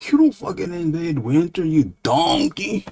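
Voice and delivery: high-pitched comic telling-off; mock scolding. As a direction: berate a fool, drawing out the insult. Acted scolding of an invader.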